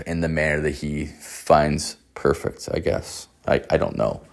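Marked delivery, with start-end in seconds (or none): none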